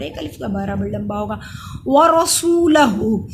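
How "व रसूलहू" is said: व रसूलहू with long soo and hoo; very slow recitation, holding सू; lengthened vowels. Shown as a demonstration. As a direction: stretch सू and the final हू. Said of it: "सू को लंबा करेंगे".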